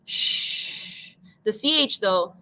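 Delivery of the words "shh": long, soft, voiceless "shh"; soft stretched shh. Shown as a demonstration. Teacher showing SH can stretch, soft sustained shh. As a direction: let the "shh" flow out steadily. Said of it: "you can stretch that one out".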